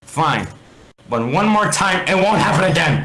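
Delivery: high, loud stern threat; angry warning. Acted. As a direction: warn him off for good. Alex warning Steve.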